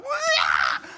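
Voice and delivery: loud laughing yelp; comic startled cry. Retold or imitated. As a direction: yelp as the snake hits your foot. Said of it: "and I was like".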